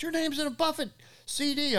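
imitating an excited mailman with news. Mailman voiced in story.

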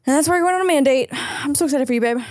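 high, fast mock announcement; teasing singsong. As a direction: tease him excitedly. Teasing echo of partner.

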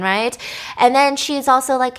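usual speaking voice

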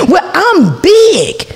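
drawn-out mocking neighbor voice; slow mock neighbor. Mocking the neighbor's excuse, drawn out. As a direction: stretch it out like a sulky neighbor. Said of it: "Did she say".

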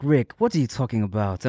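low, lightly voiced Wakandan accent; Wakanda accent. Retelling own skit to co-hosts, Wakanda accent.

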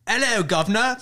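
playful fake British accent for the toddler; mock British accent. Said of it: "what I say to my toddler".

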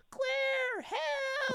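very high, whiny mock plea; Steve. Trapped game character begging Claire for help, high whiny imitation. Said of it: "It's that high-pitched".

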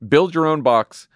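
cheerful podcast ad voice; ad read. Acted. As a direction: pitch the cereal like a host ad.

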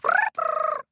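very high trilled creature cry; shouted trill. A narrator's reading. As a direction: trill loudly like a little star creature. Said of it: "shouted Starchild".

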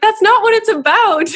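squeals it high through a laugh; laughing protest. Real, not acted. Telling the guest how she answered her husband, laughing. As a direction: laugh while brushing off the tease.

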